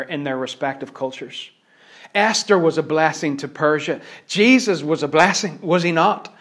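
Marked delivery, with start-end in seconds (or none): 2.1-6.3 louder, higher preaching, building through the list; rising preaching. Acted. Preacher rousing the congregation with Bible examples, building. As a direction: preach it, pile up each group.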